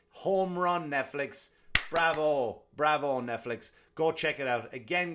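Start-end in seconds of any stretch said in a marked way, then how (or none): 0.1-3.7 cheering. Real, not acted. Cheering the show.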